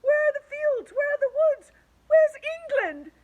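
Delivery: very high, loud wail; panicked cry. Acted. The creatures wail to each other, high and panicked. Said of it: "they cried".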